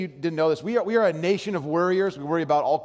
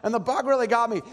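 wife scolding family; 7 semitones higher than usual. Retold or imitated. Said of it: "and she's like".

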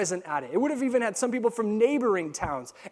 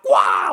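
loud mock screech; imitated pterodactyl cry; 8 dB louder than usual. Picturing a pterodactyl flying up in the sky, imitated cry. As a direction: let out a loud "wah".

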